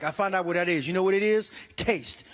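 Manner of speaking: normal talking voice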